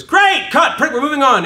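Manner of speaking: loud high brisk director call. Retold or imitated. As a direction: bark it like a satisfied director. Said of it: "the director goes".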